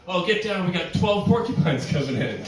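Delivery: hammed-up army warning; mock soldier alarm. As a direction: bark it like a soldier under fire, tongue in cheek. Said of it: "No one ever says, like".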